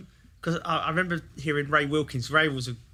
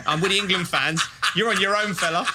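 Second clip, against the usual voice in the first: louder, laughing cheer; gleeful shout; 4 semitones higher, 5 dB louder than usual. Retold or imitated. Teen abandoning Brian to celebrate with England fans, loud and laughing. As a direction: crow it out, jumping about.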